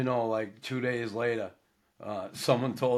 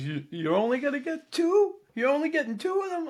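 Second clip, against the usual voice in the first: high, far-off squeal of disbelief, much higher; laughing disbelief. Retold or imitated. Buddy in the getaway car cracking up, high squeaky disbelief. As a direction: squeak it out like a giddy buddy who can't believe it. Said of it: "and he's laughing".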